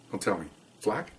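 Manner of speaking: soft, knowing guess; quiet, matter-of-fact. Retold or imitated. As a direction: guess it softly, man to man. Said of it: "matter of fact, very quiet".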